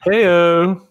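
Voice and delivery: loud high "Heyo"; excited cheer. Real, not acted. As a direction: hype it up like a sidekick. Cheering a joke.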